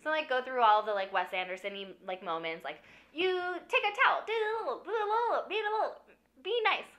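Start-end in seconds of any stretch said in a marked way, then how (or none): 3.1-6.9 high, prissy instructing voice; fussy mentor. Retold or imitated. Gustav teaching Zero lobby-boy manners, high and prissy. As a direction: coach the boy daintily, step by step.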